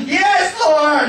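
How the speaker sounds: high, eager, laughing yes. Retold or imitated. Preacher acting his eager yes to God, high and laughing. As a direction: shout it out like a keen volunteer. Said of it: "you wanna go to the garbage dump?".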